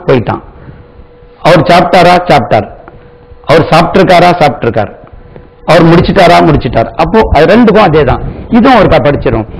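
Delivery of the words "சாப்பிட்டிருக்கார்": ordinary delivery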